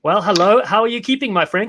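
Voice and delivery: ordinary delivery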